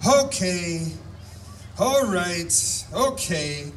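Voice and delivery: retold overwhelmed reaction, words spaced apart; visibly shaken. Healed man throwing his hands up, mildly imitated shakenness. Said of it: "you can tell he's visibly shaken".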